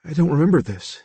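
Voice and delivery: lightly voiced confused young man. A narrator's reading. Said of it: "He seemed confused".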